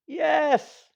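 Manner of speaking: high, loud wailing dismay; dismayed dying king. Acted. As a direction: wail as the fool you dread appears. King answers.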